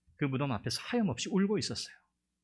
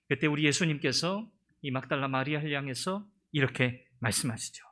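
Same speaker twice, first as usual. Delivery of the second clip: normal talking voice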